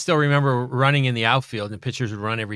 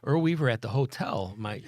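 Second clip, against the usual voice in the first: imitating his sister; 6 semitones higher, 3 dB louder than usual. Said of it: "Yeah, I called Earl Weaver".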